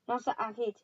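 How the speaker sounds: ordinary delivery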